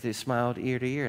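ordinary delivery

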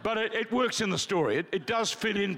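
ordinary talk, nothing marked

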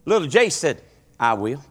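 high, loud revival storytelling; fervent preacher in full flow; preaching at full force. Acted. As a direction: drive the story home to the congregation. Preacher climaxes Jace story.

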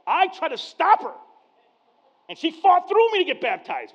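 high, loud and proud; proud excitement. Real, not acted. Pastor boasting to congregation about his daughter, high loud pride. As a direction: swell with pride at her.

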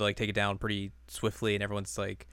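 ordinary delivery

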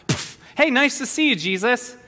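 mock casual hello. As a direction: toss off a casual greeting. Mock blind man greeting again.

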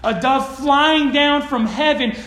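ordinary delivery

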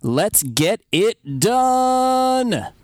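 hammy announcer. Pretend. Hammed-up intro sign-off.

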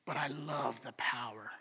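low, soft near-whisper of the Satanist; lightly voiced sinister craving; hushed menace. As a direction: breathe the words, savouring them. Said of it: "And she said".

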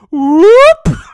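very high, loud mock whoop; high-pitched imitated buzzer whoop. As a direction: whoop out a comic game show sound effect. Mock host's imitated sound effect.

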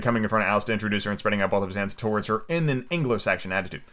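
usual speaking voice

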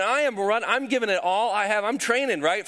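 ordinary talk

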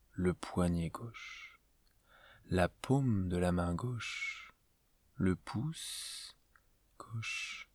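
slow, spaced-out meditation voice with long pauses; guided meditation. Acted. Teacher leading a listener into yoga nidra before sleep, calm guided meditation. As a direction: guide the listener gently through a body scan, unhurried.

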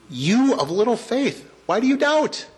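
high, lightly voiced Jesus chiding; Jesus gently rebuking. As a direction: tease a scared follower for doubting. Jesus rebukes sinking Peter.

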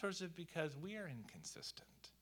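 hushed pointed question; whispered question. Real, not acted.

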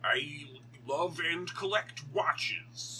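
slow, overdone fake American accent; Benedict Cumberbatch. Podcaster takes co-host's cue, slow mock American accent. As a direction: stretch out a bad American accent, half speed. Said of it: "I can't do the accent".

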